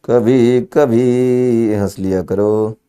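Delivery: slow, soft recited couplet line; slow and soft like a poet performing a couplet; shayari recitation. Shown as a demonstration. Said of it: "शायरी सुनोगे?".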